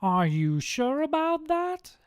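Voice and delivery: high, loud, slow incredulous question; Satan. A narrator's reading. Satan doubts the second man, high and slow. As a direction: question him loudly, pitch climbing. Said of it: "asks Satan".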